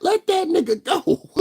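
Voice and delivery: very high, slow squealed plea; mock pleading. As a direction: stretch it out, squealing. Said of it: "I was like".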